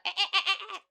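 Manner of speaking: high chirping eh-eh laugh; high squeaky dolphin chirps. Retold or imitated. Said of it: "You're like".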